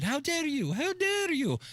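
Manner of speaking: high-pitched scolding outrage; mock an indignant teen scolding the world; Greta Thunberg. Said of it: "Can I try to mimic her voice".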